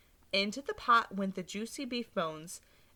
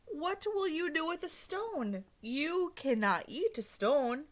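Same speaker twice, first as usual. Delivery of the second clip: put-on high, slow puzzled old lady; old lady baffled by the stranger; 7 semitones higher, slower than usual. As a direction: drag out the disbelief, pitched up.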